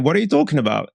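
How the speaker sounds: normal talking voice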